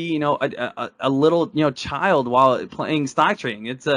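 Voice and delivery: usual speaking voice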